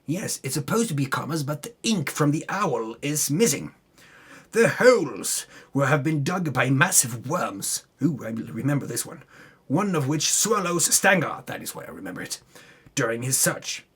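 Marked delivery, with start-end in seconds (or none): none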